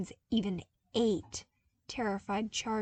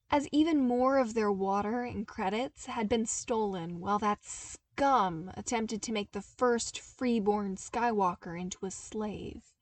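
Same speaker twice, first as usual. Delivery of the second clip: ordinary delivery, nothing marked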